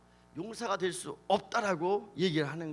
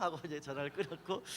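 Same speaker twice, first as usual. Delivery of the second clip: normal talking voice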